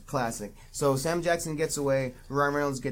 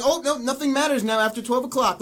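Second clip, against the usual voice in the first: higher, louder put-on voice brushing it all off; mocking official; 7 semitones higher, 5 dB louder than usual. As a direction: lampoon the dismissive official in a silly voice. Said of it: "It's like".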